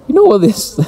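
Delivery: high-pitched, amused outburst breaking into a laugh; tickled, tipping over into laughter. Real, not acted. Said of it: "Sometimes I laugh when I hear".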